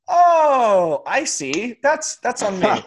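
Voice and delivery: high, drawn-out 'oh' of sudden understanding; hammed-up sheepish admission, starting loud and high; mock realization. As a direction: act out owning up, pitched well up. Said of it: "And you went".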